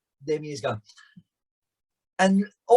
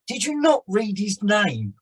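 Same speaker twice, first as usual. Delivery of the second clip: incredulous; 5 semitones higher, slower than usual. Retold or imitated. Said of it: "I thought".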